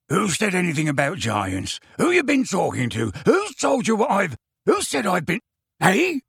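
startled questions climbing high; flustered indignation. A narrator's reading. Man whose secret is out, sputtering indignantly. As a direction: build from gruff challenge to high, sputtering protest.

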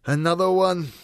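loud, high grumble from a dog; exasperated, gloomy character voice. A narrator's reading. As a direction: complain loudly, as a fed-up dog. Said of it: "said the dog gloomily".

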